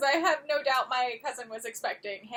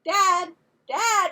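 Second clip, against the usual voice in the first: higher, louder urgent calling out; calling Dad; 5 semitones higher, 8 dB louder, much slower than usual. Retold or imitated. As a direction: grab his attention before he turns the page. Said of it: "I was like".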